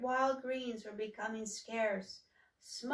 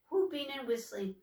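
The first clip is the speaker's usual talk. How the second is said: ordinary delivery, nothing marked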